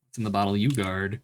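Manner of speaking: usual speaking voice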